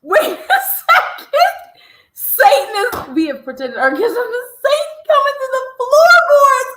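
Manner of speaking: high, loud incredulous repeat; mock disbelief. Host mocking the clip back, loud and high. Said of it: "I said".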